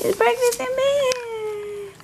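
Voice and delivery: slow, high and drawn out; excited. Real, not acted. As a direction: sing it out with delight. Surprising her with breakfast.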